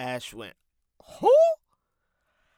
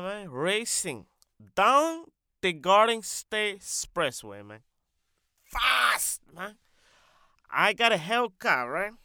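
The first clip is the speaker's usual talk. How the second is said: boastful comic character; 7 semitones higher, 8 dB louder than usual. Acted. Character bragging about his Hellcat, brash and emphatic. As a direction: brag in bursts as an accented loudmouth. Character tells driving story.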